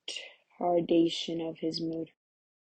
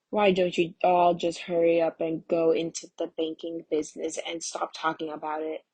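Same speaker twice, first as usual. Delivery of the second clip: ordinary talk, nothing marked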